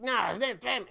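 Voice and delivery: lightly voiced brother poking fun; mocking little brother. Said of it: "and he's like".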